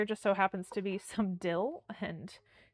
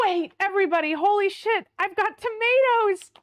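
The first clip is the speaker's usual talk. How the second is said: high, loud thrilled announcement; excited shout; 10 semitones higher, 9 dB louder than usual. Real, not acted. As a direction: blurt the discovery out to everyone. Finds tomatoes, tells viewers.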